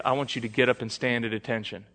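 low, hushed drill sergeant order; quietly voiced sergeant, lightly acted. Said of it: "Drill Sergeant Moses".

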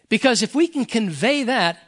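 ordinary talk

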